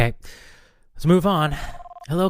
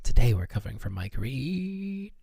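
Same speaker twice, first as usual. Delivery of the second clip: strained restraint; 4 dB quieter than usual. Acted. Host forcing out the absent presenter's name, strained. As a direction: drag out "Reed" as it tightens and climbs.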